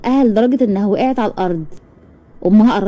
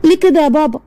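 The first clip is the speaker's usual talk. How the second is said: pleading cry; 7 semitones higher, 3 dB louder than usual. Acted. Girl pleads with beating father.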